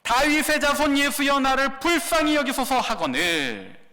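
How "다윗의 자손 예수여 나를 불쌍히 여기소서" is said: loud, higher desperate plea; crying out. Acted. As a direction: call out to him for mercy. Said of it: "소리질러 이르되".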